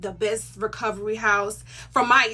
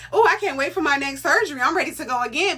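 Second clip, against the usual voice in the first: mock eager; 5 semitones higher, 4 dB louder, faster than usual. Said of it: "It makes you be like".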